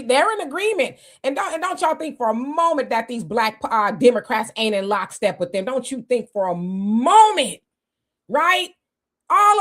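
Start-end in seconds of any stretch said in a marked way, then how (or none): none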